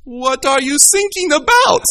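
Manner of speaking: high, slow mock German "sinking" for thinking; German accent. German trainee misunderstands the caller, mock accent. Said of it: "And he goes".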